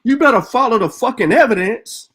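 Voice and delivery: normal talking voice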